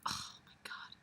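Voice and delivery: whispered disbelief to herself; real whisper. Real, not acted. Reader reacting to the plot in a whisper. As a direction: breathe it out, stunned.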